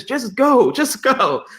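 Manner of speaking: high-pitched, exasperated push; imitated insistent urging. Pastor urging him to visit campus, mock insistence. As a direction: act out waving off every objection.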